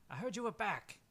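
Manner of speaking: high, put-on friendly greeting; friendly Jenny. Game master as a visitor at the door, high friendly voice. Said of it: "it's the friendly sound of Jenny".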